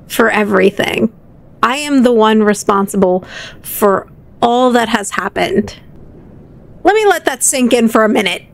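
ordinary talk, nothing marked

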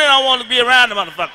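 usual speaking voice

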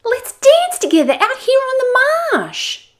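very high, loud honking bird call; loud harsh honk. Acted. Olga urging Joanna to dance, loud harsh honk. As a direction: honk it out like a brolga. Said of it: "Olga honked in a voice both loud and harsh".

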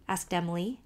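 plain reading voice

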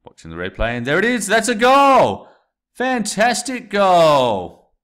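high, loud, stretched goal call; excited sports commentary. Shown as a demonstration. Teacher demoing narration as a roaring football commentator. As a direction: yell the goal like a live commentator. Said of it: "when I got excited there".

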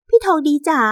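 high, wheedling "พี่ทองดีจ๋า"; coaxing mistress. Acted. Mistress calls to the man she slept with, coaxing. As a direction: coo the name to coax him, high-pitched.

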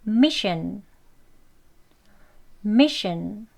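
mission with a weak shin ending; model pronunciation. Shown as a demonstration. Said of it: "발음 두 번씩 같이 해볼게요".

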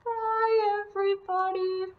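high little Yeti puppet saying bye. Pretend. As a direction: wave goodbye in a high puppet voice. Puppet Yeti says goodbye.

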